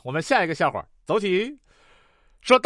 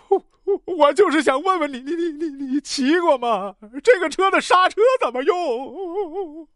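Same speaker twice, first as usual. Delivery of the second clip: high, wailing stutter of a crash victim; panicked stammer; 6 semitones higher than usual. Acted. Crashed rider stammers to driver.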